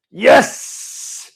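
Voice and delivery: high, loud burst of agreement; excited yes. Real, not acted. Host answering praise of his intro, excited shout. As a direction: shout a delighted yes with a long hiss.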